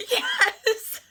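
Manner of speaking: very high squealed yes; excited scream. Retold or imitated. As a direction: squeal it with pure joy.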